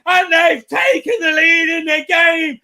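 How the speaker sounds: screaming the goal at full volume. Real, not acted. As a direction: yell it like your team just scored. Live goal reaction shouting commentary.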